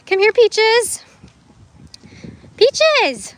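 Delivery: high, loud call to an animal. Real, not acted. Owner calling her emu from a distance, loud and high. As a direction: call across the yard to the emu.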